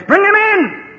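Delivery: high-pitched yelled command; shouted order. Retold or imitated. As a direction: shout the order out across the field. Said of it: "the old Sarge shouted".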